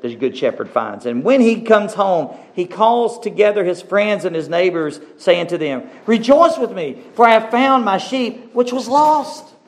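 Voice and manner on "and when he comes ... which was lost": jubilant scripture preaching. Acted. Preacher voices the shepherd calling friends to rejoice, jubilant preaching. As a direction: lift the voice high to announce the good news.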